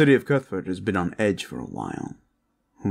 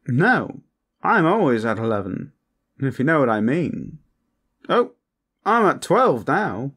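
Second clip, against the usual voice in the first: high, loud, over-the-top flirt; Hakan; 7 semitones higher, 5 dB louder than usual. Acted. Hakan defies being told to calm down, brash and flirtatious. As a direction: refuse to tone it down, pitched up and brash. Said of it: "Take it down a notch".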